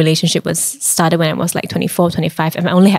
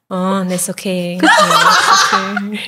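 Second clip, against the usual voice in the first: reassuring while laughing; slower than usual. Retold or imitated. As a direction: soothe him through laughter. Comforting scared date, laughing.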